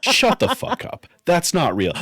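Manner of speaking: high, loud mock disbelief; disbelieving outburst. Answering Shorty about Cyberpunk, high loud disbelief. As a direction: shout down the news in disbelief.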